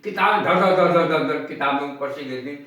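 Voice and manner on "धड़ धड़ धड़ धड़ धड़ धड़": rapid, punchy धड़ धड़ of falling books; quick imitated thuds; thudding books. Shown as a demonstration. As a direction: rattle off धड़ धड़ like books tumbling down. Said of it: "जो ध्वनि हुई".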